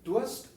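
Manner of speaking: Durst más agudo y fuerte, subiendo; Durst recalcado. Shown as a demonstration. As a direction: marca bien la palabra Durst. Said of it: "muy importante". (Durst dicho con énfasis de profesora)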